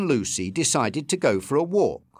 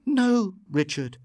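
insisting; 7 semitones higher than usual. A narrator's reading. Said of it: "insisted Lucy".

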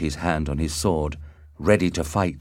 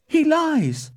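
very high, piercing accusation; outraged cry; 4 dB louder than usual. A narrator's reading. Lady Capulet rejects Benvolio's account, crying out. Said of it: "cried Lady Capulet".

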